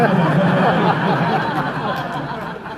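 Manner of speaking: loud real laugh after the punchline; big open laugh at his own story; hearty laugh. Real, not acted. Director laughs at Rick's quip, loud and real.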